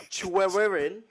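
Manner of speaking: English accent reading Welsh. Shown as a demonstration. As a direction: tackle the Welsh syllables with English sounds. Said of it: "So it'd be".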